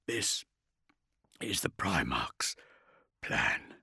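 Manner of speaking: soft, slow, halting growl; strained whispery rasp, barely his own. A narrator's reading. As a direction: growl it out a few words at a time. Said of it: "he growled, his voice barely his own".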